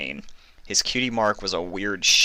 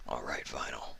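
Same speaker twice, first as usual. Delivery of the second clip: gentle; 5 semitones lower, 4 dB quieter than usual. Acted. About to inject her.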